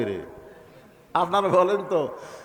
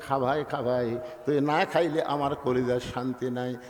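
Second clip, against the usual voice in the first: coaxing sister; 6 semitones lower, 5 dB quieter than usual. Acted. Sister coaxing brother to eat.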